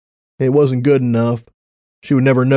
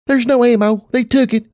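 put-on high child's voice; little girl voice; 12 semitones higher than usual. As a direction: speak as a small girl. Child answers her mother.

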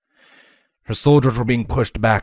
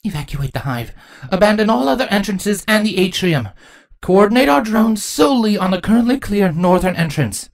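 commanding queen; 10 semitones higher than usual. Acted. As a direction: issue evacuation orders firmly.

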